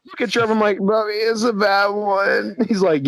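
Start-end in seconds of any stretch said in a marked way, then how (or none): 0.8-2.6 mock-sad. Same sad line, retold again.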